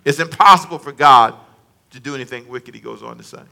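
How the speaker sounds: normal talking voice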